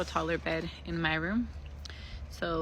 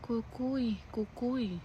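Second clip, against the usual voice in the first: put-on soft, higher small-child voice; eerie little sing-out, pitched up; half-asleep daughter; 4 semitones higher, 7 dB quieter, much slower than usual. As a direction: imitate a sleepy child calling out softly. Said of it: "and then she's like".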